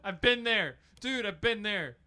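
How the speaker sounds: high, loud, worked-up storytelling; hammed-up rant. Hammed-up car repair rant.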